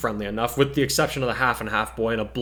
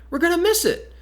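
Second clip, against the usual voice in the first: put-on much higher, insistent girl's voice; girl demanding; 3 dB louder than usual. Girl pressing the teacher about the ceremony, high and insistent.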